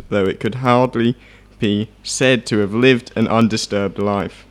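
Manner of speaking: reading aloud. Acted. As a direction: read the passage out clearly. Reads from history book.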